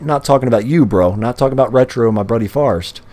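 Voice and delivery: normal talking voice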